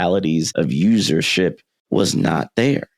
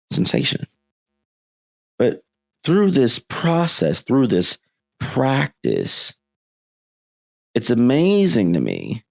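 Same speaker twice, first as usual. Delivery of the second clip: ordinary talk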